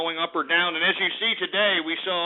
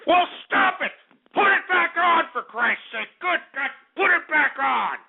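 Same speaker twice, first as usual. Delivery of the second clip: furious yelling; 9 semitones higher than usual. Real, not acted. Yelling at the engineer over chat martial law, furious. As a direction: rage at him, demand it back on. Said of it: "I'm frothing at the mouth out here 'cause I'm pissed".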